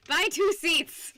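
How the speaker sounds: slow, loud pretend rant; mock outraged yell. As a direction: bark it like a fed-up passenger. Mock outrage at seat hog.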